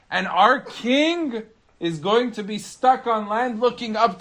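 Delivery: high, indignant bird voice, mocking the idea; a cocky bird laughing off the rumour; scoffing bird. Second bird scoffing at the first bird's warning, high and indignant.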